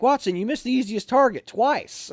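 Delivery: high-pitched mock reproach; imitated scolding. XO spotter scolding trainee for missing, imitated reproach. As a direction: scold him as the disbelieving spotter. XO scolding missed shot, acted out.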